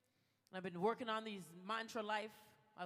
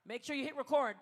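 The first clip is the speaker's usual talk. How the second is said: rally everyone to hit record; loud crowd call; 3 semitones higher, 8 dB louder than usual. Real, not acted. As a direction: call out loudly to the whole gala.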